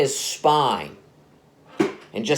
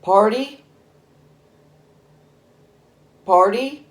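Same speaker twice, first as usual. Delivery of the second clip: put-on higher kid voice, questioning rise; little boy asking; 7 semitones higher than usual. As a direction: ask it like a small child. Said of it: "The son goes".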